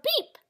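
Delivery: short high-pitched toy horn beep; a tiny, high "beep" from a little truck. A narrator's reading. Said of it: "said Blue".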